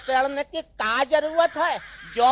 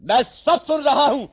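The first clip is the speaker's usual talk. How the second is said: husband bursting in; 4 dB louder than usual. Acted. Husband bursts in catching them.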